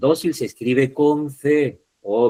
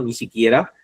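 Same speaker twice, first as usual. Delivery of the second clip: ordinary delivery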